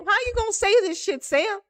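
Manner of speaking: high, quick disbelief; hammed-up high-pitched scolding; mock exasperated coach. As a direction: imitate a coach baffled by her player. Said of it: "She was like".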